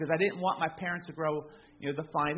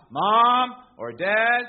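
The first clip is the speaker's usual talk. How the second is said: put-on loud child's call in the night; crying out; 2 semitones higher, 8 dB louder, much slower than usual. Son calling for mom and dad at night, loud. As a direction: call out for a parent from upstairs. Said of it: "he was the one that would cry out".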